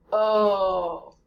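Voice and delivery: a held, steady English oh; held O sound. Shown as a demonstration. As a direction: stay on the O of hello. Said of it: "stay on the O sound".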